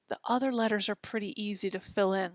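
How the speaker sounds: ordinary talk, nothing marked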